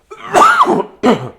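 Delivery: big sudden sneeze; loud sneeze. Real, not acted. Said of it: "that was a big sneeze".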